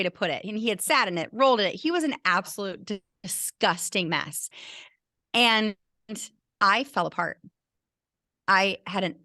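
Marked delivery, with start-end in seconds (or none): none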